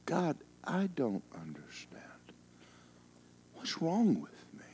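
high breathy whisper to God; a lost son whispering to God; whispered plea. Retold or imitated. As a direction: plead in a hushed whisper. Desperate retold prayer.

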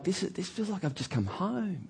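high and very soft, like a sigh of relief; tender, almost whispered homecoming; hushed awe. Retold or imitated. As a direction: breathe it as if finally home. Newcomer's feeling quoted.

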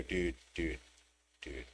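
low, soft, mellow dudes; contented dudes. Acted. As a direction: sigh dude at the sunset. Watching sunset together.

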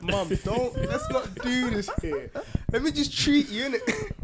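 high, loud pleading with a laugh; laughing plea to mum. Retold or imitated. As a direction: coax mum to let him pay, laughing.

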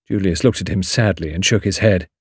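ordinary delivery, nothing marked

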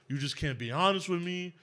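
normal talking voice

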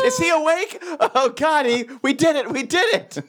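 high, excited movie-scientist triumph; mock jubilation. Imitating surgeons as the patient comes to, mock jubilation. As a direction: celebrate in a hammed-up high voice.

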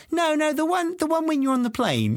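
high-pitched imitation of a child; young daughter. Daughter asking for her favourite bedtime version, imitated child voice. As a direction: pitch it way up, like an insistent little girl. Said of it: "She goes".

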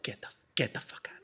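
whispered disbelief, barely voiced; stunned whisper. Caller whispering in disbelief while on hold, stunned whisper. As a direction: whisper it in shock. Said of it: "I'm like".

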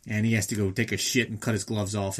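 ordinary delivery

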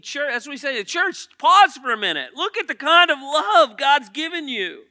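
high, loud exhortation, pressing each phrase; full-force preaching. Acted. Preacher urging the church to see God's love, at full force.

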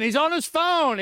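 mild mocking jab at a distracted god. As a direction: sneer it like Elijah mocking the prophets. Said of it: "Elijah began to mock 'em".

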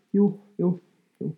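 curt, sulky "Jo, jo, jo"; grumpy. Retold or imitated. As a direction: answer like you're a bit put out. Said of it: "lite tjuriga".

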